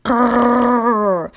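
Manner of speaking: hammed-up engine growl; mock roar. A narrator's reading. Diesel losing patience with the trucks, mock growling roar. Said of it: "roared Diesel".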